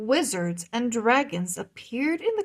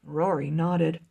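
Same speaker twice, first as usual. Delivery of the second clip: usual speaking voice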